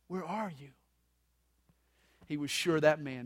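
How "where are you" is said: soft, loving question; tender, loving. Shown as a demonstration. Pastor reading God's call to Adam, tenderly with love. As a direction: read it tenderly, much softer. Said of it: "if he read it tenderly and with love".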